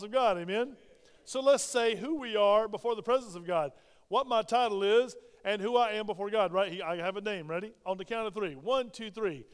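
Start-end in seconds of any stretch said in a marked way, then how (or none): none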